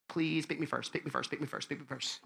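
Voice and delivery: very soft, repeated under-the-breath begging; anxious pleading. Acted. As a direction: wish it quietly like an awkward kid awaiting selection. Anxious child awaiting kickball pick.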